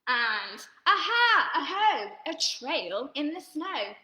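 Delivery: slow, loud, drawn-out chant; dramatic refrain. A narrator's reading. Reading to children at home, a big suspenseful chant. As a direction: make it a big suspenseful story moment.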